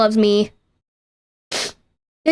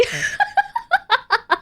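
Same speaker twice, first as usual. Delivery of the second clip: high squealing laugh; 4 dB quieter than usual. Real, not acted. Laughing at her own bragging.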